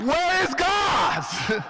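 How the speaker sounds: rhetorical shout. Acted. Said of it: "It's a question we must ask".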